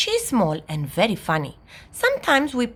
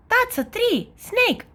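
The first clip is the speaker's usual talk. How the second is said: put-on excited shouting child; slower than usual. A narrator's reading. Little sister takes her turn, shouting excitedly. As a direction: call out high and eagerly. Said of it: "she shouts".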